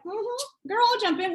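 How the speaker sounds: high, sassy mock yes; playful agreement. Imagined friend accepting the invite, playfully keen.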